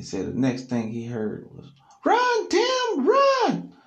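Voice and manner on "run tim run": very high, loud frantic cry; panicked warning. Retold or imitated. Alan warning Tim to flee, very high panicked yell. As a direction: scream it while fleeing.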